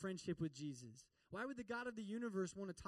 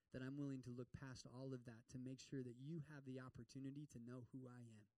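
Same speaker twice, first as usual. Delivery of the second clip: softer, gentle voice of loving acceptance; tender reassurance; 6 semitones lower, 7 dB quieter than usual. Retold or imitated. Jesus quietly reassures the woman, soft and tender. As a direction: say it like a quiet promise.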